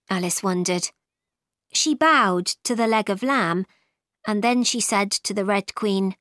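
plain reading voice

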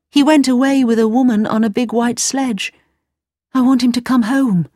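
put-on young girl pleading for her friend; little girl Gerda. A narrator's reading. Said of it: "Gerda speaking in story".

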